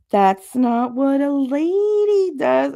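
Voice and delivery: put-on high, slow prissy old aunt voice; Aunt Marie. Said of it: "That was my Aunt Marie".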